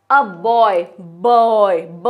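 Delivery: put-on boy drawn out, then ब alone as "buh"; teacherly slow sounding-out of boy down to ब; sounding out onset. Shown as a demonstration. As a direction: stretch बॉय and peel off its b sound, ब. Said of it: "पहले ब की sound आ रही है".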